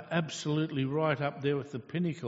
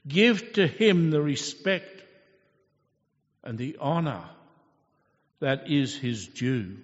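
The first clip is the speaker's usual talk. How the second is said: proclaiming Father; 2 semitones higher, 6 dB louder than usual. Acted. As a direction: declare it with authority. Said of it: "God the Father says".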